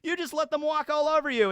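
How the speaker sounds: high-pitched mock indignation; imitated upset protest. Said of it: "he's very upset".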